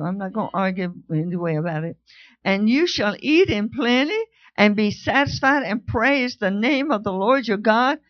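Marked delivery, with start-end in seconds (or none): 2.4-8.0 full-force preaching. Acted. To the congregation, full-force preaching. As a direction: proclaim it with fire.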